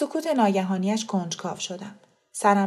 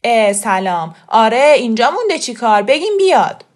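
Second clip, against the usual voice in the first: brusque raised voice; loud impatient reply; 2 semitones higher, 10 dB louder than usual. Acted. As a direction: answer loudly, in a hurry to fetch her. Khosrow brusquely answers the mother.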